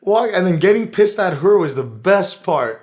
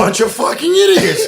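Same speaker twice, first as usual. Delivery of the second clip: higher, laughing scorn; 9 semitones higher, 4 dB louder than usual. Real, not acted. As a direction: sneer it through a laugh. Mocking flat earthers, laughing.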